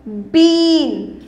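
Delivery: बीन with a long, drawn-out ee; बीन, ई खींचकर; long ee, stressed. Shown as a demonstration. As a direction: hold the ee in बीन. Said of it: "उसको थोड़ा सा ज़ोर की बोलेंगे".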